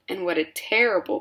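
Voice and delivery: ordinary delivery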